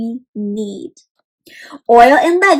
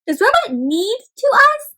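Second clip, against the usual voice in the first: mock surprise; 10 semitones higher than usual. Shocked robot question.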